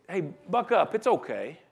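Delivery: softly, like an arm round a friend's shoulder; quiet consoling pat on the back; gentle comfort. Retold or imitated. Paul comforting with arm around them.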